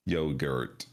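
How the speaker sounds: lightly voiced robotic yogurt, low and even; Google robot voice. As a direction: say yogurt like a computer voice. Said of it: "computerized digital voice of Google".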